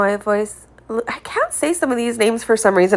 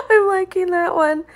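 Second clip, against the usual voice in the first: giggling approval; laughing; 7 semitones higher than usual. Real, not acted. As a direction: still laughing as she says it. Likes a mocking comment.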